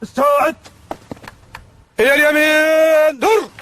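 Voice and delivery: loud drill command; barked order. Acted. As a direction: bark the order out. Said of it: "drill commands to recruits".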